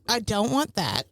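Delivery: ordinary talk, nothing marked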